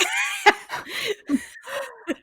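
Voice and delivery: high-pitched burst of laughter. Real, not acted. Reader cracking up at the kidney punch, laughing.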